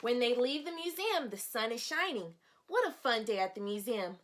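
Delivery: put-on bright read-aloud voice for children; storybook reading. Teacher reading the storybook to her young students. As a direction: read the page like story time.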